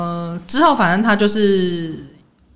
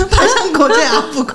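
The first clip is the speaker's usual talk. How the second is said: higher, louder, cracking up on the joke; laughing punchline; 8 semitones higher, 4 dB louder than usual. Real, not acted. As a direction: blurt it out mid-laugh. (边笑边说笑点)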